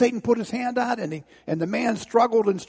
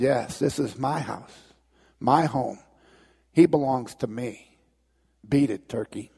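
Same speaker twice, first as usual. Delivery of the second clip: calm, firm Jesus; 6 semitones lower than usual. Acted. Jesus calmly dismisses Satan.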